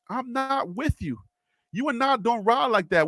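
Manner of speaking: ordinary delivery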